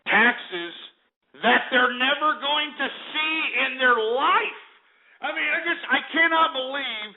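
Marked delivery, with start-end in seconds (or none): 1.4-7.1 indignant disbelief. Real, not acted. Ranting about unfair taxes.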